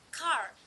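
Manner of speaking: car with the final R clearly pronounced. Shown as a demonstration. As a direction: sound the R at the end of car. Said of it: "pronounced with a clear R".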